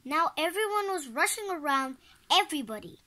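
plain reading voice

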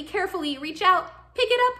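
high, loud, wary lift; pretend careful effort. Reader leads children lifting a spiky urchin, nervously careful. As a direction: pick up something prickly, voice jumping up.

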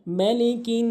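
মালিকিন with a held nasal end; মালিকিন clipped short on the vowels; tajweed demo; recited word. Shown as a demonstration. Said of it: "অনেকে ভুল করে মালিকি বলি".

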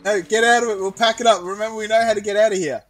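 loud, fast, urging; urgent loud. Real, not acted. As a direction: push them to move quickly. Urging after crash.

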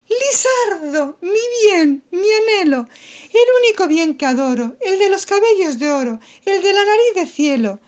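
high, loud lovesick damsel voice; hammed-up high maiden in love; swooning maiden. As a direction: gush at your lover like a melodrama heroine. Pepa swoons over Lizardo.

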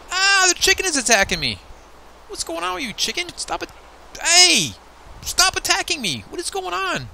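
very high, squealing mock panic; alarmed protest. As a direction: squeal as if under attack. Attacked by chicken in game.

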